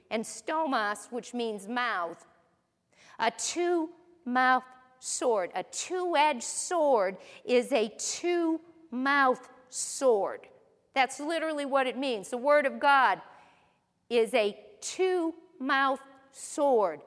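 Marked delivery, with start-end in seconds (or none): 3.2-5.5 slow emphasis. Shown as a demonstration. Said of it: "slow emphatic term". 5.6-10.5 slow emphasis. Shown as a demonstration. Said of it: "slow emphatic definition". 14.1-17.0 slow emphasis. Shown as a demonstration. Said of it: "slow emphatic term".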